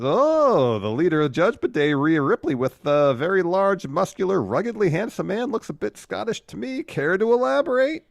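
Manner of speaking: high, loud sneering impression of Seth; sing-song mock as he taunts Drew. Podcast host mimics Seth taunting Drew, high and loud.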